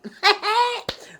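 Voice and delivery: sudden high-pitched cackle; loud high laugh. Real, not acted. Woman amused by her younger self, bursts into high laughter.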